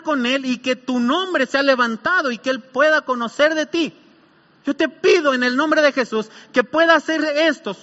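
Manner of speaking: beseech God with rising urgency; oración de petición. Shown as a demonstration. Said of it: "a Dios le pedimos en el nombre de Jesús". (oración ferviente, suplicando)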